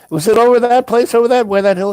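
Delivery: high, loud rush of excitement; excited realization. Retold or imitated. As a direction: blurt it out as the penny drops. Retold sudden realization, excited question.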